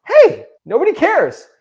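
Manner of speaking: cheerful liberated take; upbeat. Shown as a demonstration. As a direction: say "nobody cares" like good news. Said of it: "It's the same sentence".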